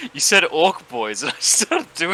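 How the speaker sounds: giggling through the words; laughing at the orc voice. Real, not acted. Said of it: "It's the orc boy's voice".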